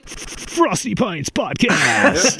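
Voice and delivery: slow, drawn-out, laughing announcer call; mock announcer. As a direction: stretch it out like a show intro.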